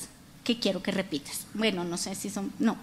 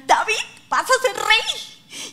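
laughing prophet voice; 10 semitones higher, slower than usual. Acted. Prophet Natán announces to David.

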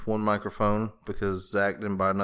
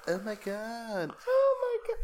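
mock gasping shock; 12 semitones higher than usual. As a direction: squeal it like a shocked fan. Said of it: "Just be all like".

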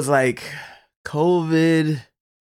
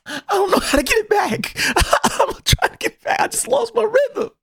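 high, hammed-up crying whine; mock whining. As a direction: whimper it like a sulking kid. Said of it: "I'm just looking sad".

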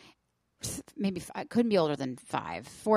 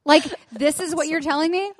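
indignant; 8 semitones higher, 7 dB louder than usual. Retold or imitated. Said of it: "I'm like".

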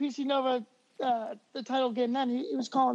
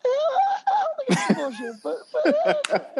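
laughing; 4 dB louder, slower than usual. Real, not acted. Laughing defense of his take.

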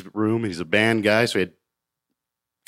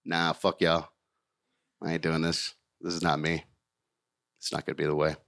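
low, quiet, lower-pitched refusal; under the breath, done with it; defeated refusal; 6 semitones lower, 8 dB quieter than usual. Retold or imitated. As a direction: mutter it, fed up and beaten. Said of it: "I'm like".

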